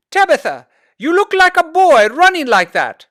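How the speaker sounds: high, loud scolding mother; frustrated yell. A narrator's reading. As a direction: scold her daughter, shouting. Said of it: "yelled out in frustration".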